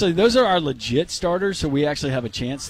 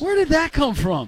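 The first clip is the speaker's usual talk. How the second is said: incredulous; 10 semitones higher than usual. Real, not acted. Mock outrage continues.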